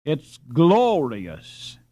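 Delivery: drawn-out awe, slow and full; enthusiastic, slow. Acted. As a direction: savour the word glorious. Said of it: "cried Ben enthusiastically".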